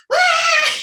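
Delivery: very high, very loud mock shriek from next door; imitated joyful scream. Singer imitating her bandmate screaming next door, joyful. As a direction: copy a bandmate's scream of joy.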